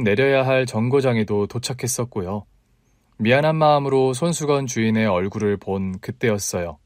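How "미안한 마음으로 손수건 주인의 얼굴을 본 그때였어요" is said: plain reading voice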